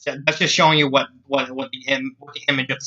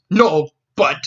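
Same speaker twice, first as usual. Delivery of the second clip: mild shout; 4 dB louder than usual. Acted. Jack trying to interrupt the Scotswoman, mild shout. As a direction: protest a little louder than usual. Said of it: "he shouted".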